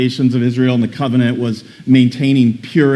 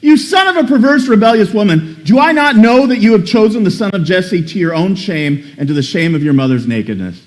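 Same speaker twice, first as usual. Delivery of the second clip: angry king; 4 semitones higher than usual. Acted. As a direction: hurl it at Jonathan in rage. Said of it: "And he said to him".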